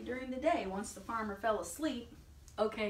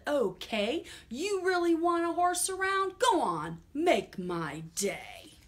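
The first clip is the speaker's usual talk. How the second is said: defiant farmer; 8 semitones higher, 6 dB louder, slower than usual. Acted. Farmer challenging the horses, mock-tough dare. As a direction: dare them, then growl it out slowly. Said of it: "farmer said".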